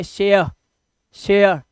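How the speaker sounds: "शेयर" with a gliding e-uh vowel; "शेयर" as she-uh, each one isolated; careful share. Shown as a demonstration. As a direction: model "शेयर" slowly apart, vowel sliding into schwa. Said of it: "तो ध्यान से सुनिए".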